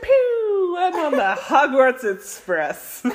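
giddy, shrieking with laughter; high and thrilled, squealing; stoned excitement. Real, not acted. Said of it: "When that weed hits your brain".

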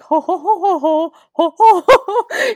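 high, loud, sinister ho-ho laugh; evil laugh. Retold or imitated. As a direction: cackle it like a creepy grandpa. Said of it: "him just going".

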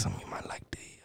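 barely audible, teasing whisper; playful whisper. Pretend. Guest teasing shawty with something nice, very soft playful whisper. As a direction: whisper it as a mock-seductive secret. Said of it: "Let me whisper".

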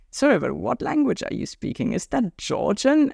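asking a stranger on a train, high and curious. Retold or imitated. I ask people.

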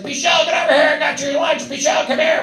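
high shouted call across the site; mock mom bellowing across the job site; mom yelling. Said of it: "So she's yelling it".

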